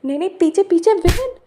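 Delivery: loud, high alarmed cry; frightened shout warning the villain of an attacker. Acted. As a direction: scream the warning to look behind.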